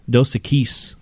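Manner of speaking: "Equis" said uh-KEECE, stress on the end; mispronunciation. Retold or imitated. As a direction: imitate others saying "Equis" as uh-KEECE. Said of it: "whenever somebody says".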